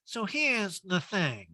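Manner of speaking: slow, high, drawn-out impression; Erica. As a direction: mimic someone's drawn-out catchphrase. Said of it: "And Erica's like".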